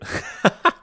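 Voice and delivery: real burst of laughter; helpless laugh at a slip; amused laugh. Real, not acted. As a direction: crack up at your own typo. Cracks up at own script typo.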